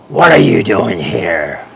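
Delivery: low, slow demonic voice; low slow possessed growl. Acted. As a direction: drag it out like something inside him speaks. Possessed officer growls at priest.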